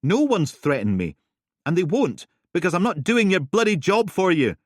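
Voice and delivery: loud, high, furious; angry retort. Acted. Helen yelling at Steele, furious and loud. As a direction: throw it back in her face.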